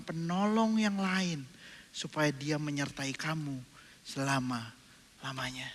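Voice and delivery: slow, soft solemn reading; solemn verse read slowly and softly; slow scripture reading. Acted. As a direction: draw out the promise gently.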